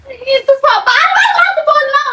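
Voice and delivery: tearful shout. Real, not acted. Upset girlfriend yells laughing.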